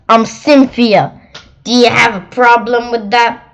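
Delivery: loud, challenging monster voice; defiant monster. Acted. Cynthia challenging the child, loud and defiant. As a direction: dare him to object. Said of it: "she snapped".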